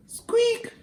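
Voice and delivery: tiny squeak, very high; high mouse squeak. Acted.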